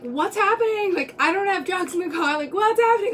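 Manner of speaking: high, anxious questions tumbling out; nervous panic. Retold or imitated. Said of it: "Like, I was so nervous".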